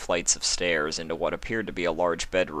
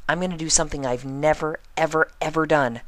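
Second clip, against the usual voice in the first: high, quick, serious pony voice; earnest Pinkie; 9 semitones higher than usual. Acted. Pinkie confiding in Spike, high and earnest. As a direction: confide a big secret, high and fast.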